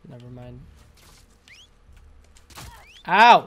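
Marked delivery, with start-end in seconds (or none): 3.1-3.5 loud sharp ow; pained yelp. Real, not acted. Gamer taking damage, loud pained yelp. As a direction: yelp at the hit.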